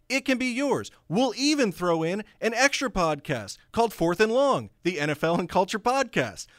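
loud, high hard-sell pitchman; home shopping host. Acted. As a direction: push it like a TV infomercial. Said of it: "this hard sell".

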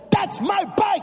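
high shout of That's my bike; mock shout. Mom shouting at the knocked-down thieves. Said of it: "She said".

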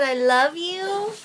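high, slow playful doctor voice; lightly voiced doctor, drawn out. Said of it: "The doctor said".

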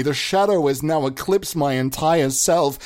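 ordinary talk

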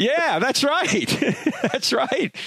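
high-pitched, slow laughing agreement. Real, not acted. Laughing at the iPhone joke.

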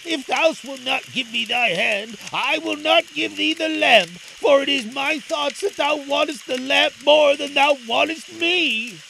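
put-on high, defiant boy's voice; growing obstinate. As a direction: dig in and refuse, pitched well up. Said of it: "growing obstinate".

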